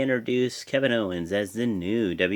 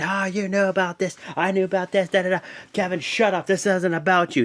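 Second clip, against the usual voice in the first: higher-pitched mock rant at Stephanie; mock yelling; 6 semitones higher than usual. Seth yelling at Stephanie in the ring, mock angry rant. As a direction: imitate Seth yelling, hammed up a bit. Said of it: "he's yelling at Stephanie".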